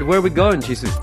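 ordinary delivery

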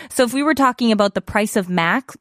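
ordinary talk

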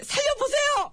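high-pitched shouted plea; shouted appeal. Acted. Underling calling out on the king's orders, high shout. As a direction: call it out high like a drilled underling.

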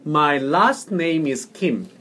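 very slow, clear English for repeating; teacher's unhurried model; slow model reading. Shown as a demonstration. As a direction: say it slowly for a learner to copy. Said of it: "해보세요".

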